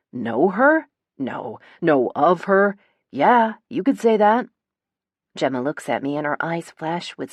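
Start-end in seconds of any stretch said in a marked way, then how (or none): 0.1-4.5 mocking echo with louder, rising stresses; bitter, cold. A narrator's reading. Brother scorns the question about knowing Gemma, bitter and cold. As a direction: throw it back bitterly, punching the repeats. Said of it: "but it's bitter and cold".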